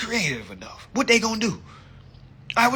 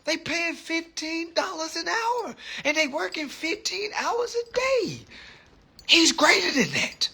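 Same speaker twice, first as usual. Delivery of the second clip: much higher, put-on voice defending Grant; exasperated defender; 10 semitones higher than usual. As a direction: plead his case in a high, disbelieving voice. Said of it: "I'm like".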